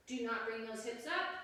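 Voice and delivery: ordinary talk, nothing marked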